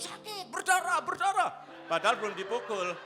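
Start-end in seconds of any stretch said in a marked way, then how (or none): 0.0-1.5 high-pitched mock wailing of a child; hammed-up panicked yelping; pretend pain cries. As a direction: cry out in exaggerated pain before any blow lands. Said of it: "Sebelum dipukul dia sudah bilang".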